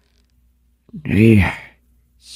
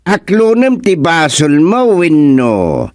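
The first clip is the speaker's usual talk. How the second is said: stern accusation; 9 semitones higher than usual. Acted. Kagawad confronting accused thief.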